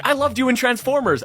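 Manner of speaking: high-pitched gushing fan; imitated fawning praise; starstruck dad. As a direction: gush like a smitten dad. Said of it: "they were equally starstruck".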